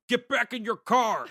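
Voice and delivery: put-on high, loud, slow Southern accent yell; Southern accent panic shout, high and loud. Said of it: "in a Southern accent".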